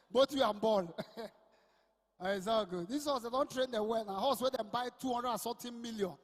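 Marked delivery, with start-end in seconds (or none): none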